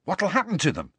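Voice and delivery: high, eager question; eager, lifted pitch. A narrator's reading. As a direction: ask keenly, hungry for the answer. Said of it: "said Ron eagerly".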